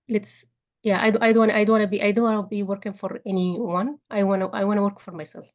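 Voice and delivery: ordinary talk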